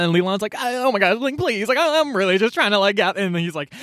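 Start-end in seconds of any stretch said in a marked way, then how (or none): 0.5-3.2 put-on higher, pleading stammer of a caught girl; flustered pleading. Leland pleading with Ling at the party, flustered and higher. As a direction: beg your way out, stumbling over words.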